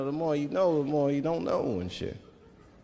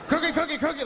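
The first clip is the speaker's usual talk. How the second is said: Cookie Monster scream; 11 semitones higher than usual. Retold or imitated.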